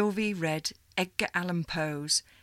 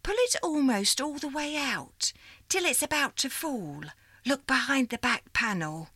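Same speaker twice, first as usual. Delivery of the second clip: put-on old operator lady; 4 semitones higher than usual. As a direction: greet her like a fond old supervisor. Miss Liza gives instructions.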